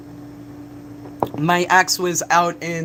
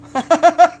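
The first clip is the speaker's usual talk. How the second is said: high burst of laughter; 11 semitones higher, 8 dB louder than usual. Real, not acted. Laughing at own joke.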